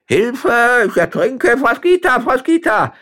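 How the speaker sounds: high, loud drowning scream; frantic, high-pitched shouting; desperate cry for help. A narrator's reading. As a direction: shriek for rescue at the top of your voice. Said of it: "rief eine Männerstimme in verzweifeltem Ton".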